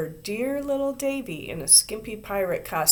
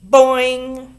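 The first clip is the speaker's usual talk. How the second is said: loud high boing; yelled boing; 5 semitones higher, 13 dB louder than usual. A narrator's reading. Ramona tweaking Susan's curl, yelling loudly. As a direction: shout the boing with glee.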